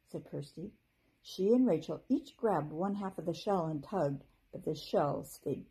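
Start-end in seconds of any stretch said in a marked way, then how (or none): none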